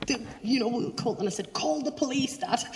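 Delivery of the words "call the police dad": retold shout. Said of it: "I shouted at this woman".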